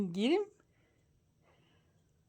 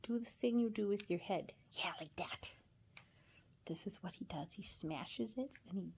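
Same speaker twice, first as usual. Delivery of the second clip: whispering; 8 dB quieter than usual. Real, not acted. Whispering to not startle bird.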